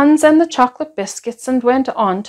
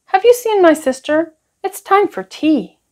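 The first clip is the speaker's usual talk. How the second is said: put-on much higher, eager child's question; high boyish voice asking; little boy asking; 8 semitones higher than usual. A narrator's reading. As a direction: sing it up like an eager small boy. Ben asking Prue.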